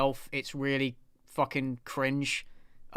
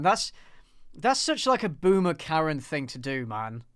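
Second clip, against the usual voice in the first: normal talking voice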